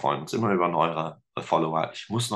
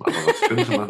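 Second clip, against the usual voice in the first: words squeezed out through a laugh; amused, breaking into a high laugh; 13 semitones higher, 5 dB louder than usual. Real, not acted. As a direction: laugh your way into the question. Laughing while searching for words.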